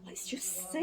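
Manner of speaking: hushed secretive whisper; a conspiratorial whisper to the children. Acted. Peeking at next page.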